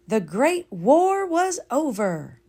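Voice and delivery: slow, solemn echo; understated solemn repeat; slow sincere repeat. A narrator's reading. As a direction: savour the words slowly. Second, slower take.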